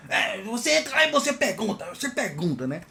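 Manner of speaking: mocking brush-off. Colleague sending the newcomer off to ask, mocking high voice.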